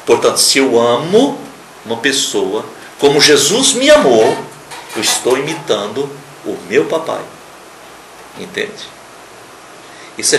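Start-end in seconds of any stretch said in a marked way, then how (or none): none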